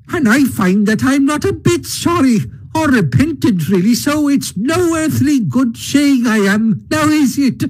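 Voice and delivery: high, swooping, self-justifying voice; impatient Toad. A narrator's reading. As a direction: argue airily and unrepentantly as Toad. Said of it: "said Toad impatiently".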